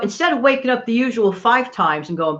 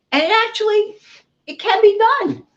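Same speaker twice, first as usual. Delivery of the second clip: excited; 10 semitones higher than usual. Real, not acted. Delighted reveal after recipe.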